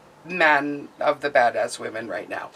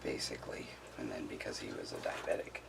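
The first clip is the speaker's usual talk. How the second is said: whispering sadly; 15 dB quieter than usual. Real, not acted. Telling of father-in-law's death.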